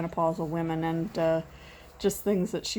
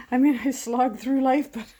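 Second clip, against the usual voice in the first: laughing while she talks; 7 semitones higher, 5 dB louder than usual. Real, not acted. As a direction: laugh through the words. Laughing at herself.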